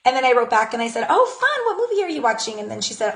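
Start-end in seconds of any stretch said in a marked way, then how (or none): 1.1-2.6 much higher, chirpy and upbeat; cheerful chat reply. Retold or imitated. Replying to a friend about her birthday plans, chirpy and high. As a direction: sound delighted and interested.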